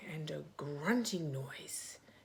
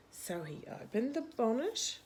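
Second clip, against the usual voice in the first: plain reading voice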